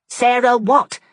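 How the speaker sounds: sharp demand. A narrator's reading. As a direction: snap the question to force an answer. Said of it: "asked Fanny sharply".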